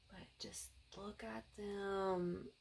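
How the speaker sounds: slow, breathy whisper of awe; hushed whisper. Real, not acted. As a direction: whisper it as if showing a treasure. Showing palette shadows up close.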